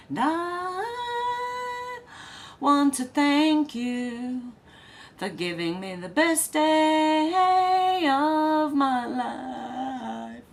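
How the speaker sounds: singing short of breath; gasping between sung phrases. Real, not acted. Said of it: "I'm running out of breath".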